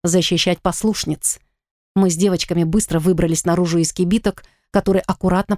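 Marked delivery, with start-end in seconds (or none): none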